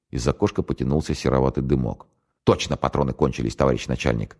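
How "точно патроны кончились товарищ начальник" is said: ordinary delivery, nothing marked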